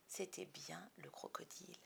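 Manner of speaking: whispered suspense narration; hushed. A narrator's reading. Narrator whispers the reveal to listeners. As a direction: drop to a hush.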